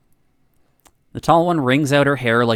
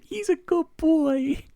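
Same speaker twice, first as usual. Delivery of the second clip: put-on very high, slow baby-talk coo; stretched-out, high-pitched doggy talk; cutesy pet talk; 4 dB quieter, slower than usual. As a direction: coo to a puppy in a tiny high voice. Gushing over a dog character.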